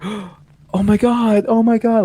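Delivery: gasped, high-pitched, drawn-out mock crying; imitated tearful shock. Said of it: "Like, she's still crying".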